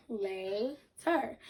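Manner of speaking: "later" split as drawn-out "lay", then "ter"; two separate syllables. Shown as a demonstration. Teacher repeating the breakdown for pupils, syllable by syllable. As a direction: hold "lay" in "later", pause before "ter". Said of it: "break down later into two syllables".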